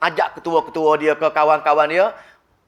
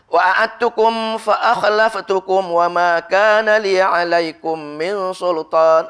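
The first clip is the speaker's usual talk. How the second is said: slow chanted verse, a little louder; Quranic recitation; 3 dB louder, much slower than usual. Acted. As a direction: chant it slowly, every syllable held.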